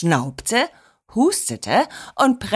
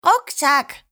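higher, hurried shout-out; child's call; 5 semitones higher than usual. A narrator's reading. Marinette rushes to leave, calling out high. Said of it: "rief Marinette".

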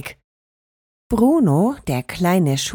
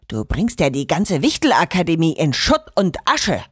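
put-on furious old headmaster; 5 semitones lower than usual. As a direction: lower voice of an old headmaster, angry and scolding. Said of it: "zitterte vor Wut". (tiefe, wütende Stimme eines alten Schulrektors)

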